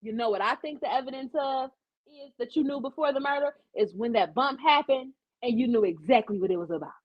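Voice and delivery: normal talking voice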